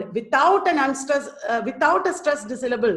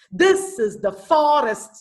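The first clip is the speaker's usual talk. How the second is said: THIS is the FOrest, slow; initial stress; 3 semitones higher, 4 dB louder, slower than usual. Shown as a demonstration. Teacher demonstrating dactylic hexameter, slow stressed recitation. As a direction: slowly punch "This" and the start of "forest". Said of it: "initial, uh, syllable is stressed".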